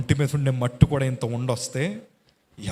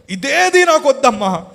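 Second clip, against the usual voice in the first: much higher and louder pleading scream; anguished cry; 12 semitones higher, 9 dB louder than usual. Retold or imitated. Patient in agony crying to his mother, loud anguished scream. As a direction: scream out the refusal in pain. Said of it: "ఏడుస్తున్నాను".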